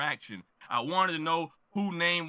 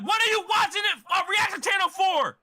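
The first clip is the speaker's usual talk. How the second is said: much higher, louder, incredulous rant; exasperated shout; 9 semitones higher, 3 dB louder than usual. Retold or imitated. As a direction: yell it at the commenters like it's obvious. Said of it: "I'm like".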